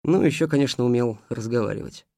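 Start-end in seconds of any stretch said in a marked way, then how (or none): none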